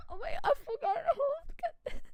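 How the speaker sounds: high, squeaky words through laughter; laughing hard. Real, not acted. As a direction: crack up while admitting a slip. Cracks up forgetting her Spanish.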